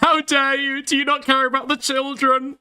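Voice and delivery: high, hammed-up indignant voice; mock outrage. Mocking a critic's angry statement.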